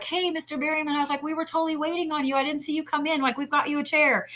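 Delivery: high, quick, sweetly chirpy; fake cheerful rescue. Rescuing the actor from drunk ladies, high chirpy pretend greeting. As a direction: sing it out like you're saving a friend.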